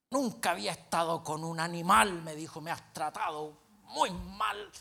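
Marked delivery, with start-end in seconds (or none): 0.1-2.2 loud, indignant mock outrage; punched-out accusation, retold; furious retort. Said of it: "furioso, y me dice". 2.6-4.7 slow, drawn-out mock grievance; acted offended whimper; whining hurt. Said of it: "Y se fue lloriqueando".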